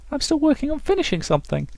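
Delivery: very high falsetto excuse; artist character. Acted. Artist with no completed works, in high falsetto.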